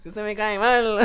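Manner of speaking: put-on over-the-top local Colombian accent, loud; mock local accent again. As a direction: push the accent further for a laugh. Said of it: "Qué acento tan feo".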